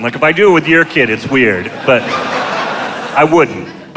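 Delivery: ordinary delivery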